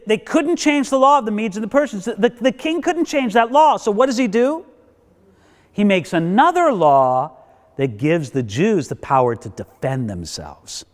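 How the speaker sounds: normal talking voice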